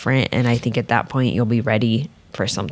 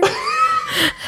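mock shrieking laugh. Mimics his recorded laughter.

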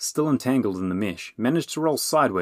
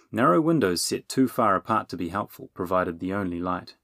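ordinary delivery

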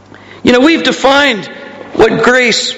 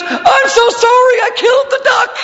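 put-on child's confession; 11 semitones higher than usual. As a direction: confess it like a little boy who can't hold it in. Son confesses at dinner.

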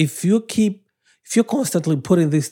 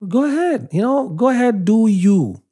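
much higher, offhand wave-off; dismissive go ahead; 8 semitones higher than usual. Real, not acted. Preacher waving off critics with a dismissive, much higher "go ahead". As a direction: brush them off, go do you.

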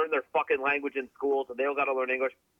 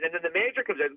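ordinary talk

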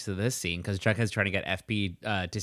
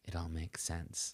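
much softer, slow dawning murmur; quiet realization; 6 semitones lower, 17 dB quieter, slower than usual. Retold or imitated. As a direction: let it sink in quietly. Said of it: "he's like".

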